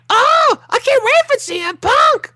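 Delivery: put-on very high squeaky excited kid; Mickey Mouse voice. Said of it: "he sounds like Mickey Mouse".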